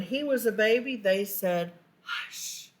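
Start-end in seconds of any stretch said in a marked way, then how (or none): 2.0-2.7 a soft, nice whisper: hush; gentle whispered hush. Acted. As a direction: whisper it kindly, like soothing a baby.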